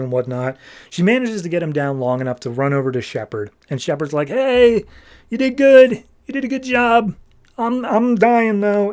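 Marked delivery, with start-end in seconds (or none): 4.3-8.9 high, slow, strained dying voice; a wounded man's pitched-up farewell; dying hero. Acted. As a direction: gasp out last words as a dying friend. Dying Shepard voiced in retelling.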